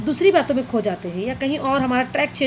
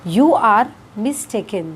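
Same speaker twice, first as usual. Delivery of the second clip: slow teaching demo; much slower than usual. Shown as a demonstration. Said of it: "तो English में कहेंगे".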